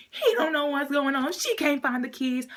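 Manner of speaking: high, loud, agitated narration; worked-up retelling. Retold or imitated. Worked up retelling the keys scene.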